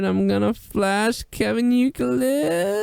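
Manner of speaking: hammed-up teasing girl voice. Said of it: "She's like".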